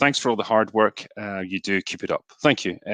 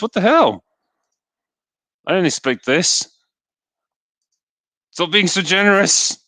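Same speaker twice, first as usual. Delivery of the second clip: higher, louder mock outrage; playful disbelief; 9 semitones higher, 4 dB louder than usual. Real, not acted. Streamer scolding chat for donating so much, mock outrage. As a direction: protest the generosity, half laughing.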